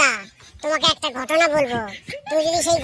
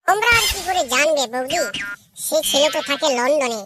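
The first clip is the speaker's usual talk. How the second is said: ordinary talk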